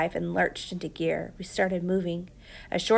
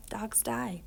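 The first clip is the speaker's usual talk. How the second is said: "Dogs die" whispered, breathy and far softer; haunted whisper; 11 dB quieter than usual. Acted. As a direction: let the words echo like a memory. Boy's own words echoing inside.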